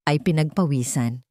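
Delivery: ordinary delivery, nothing marked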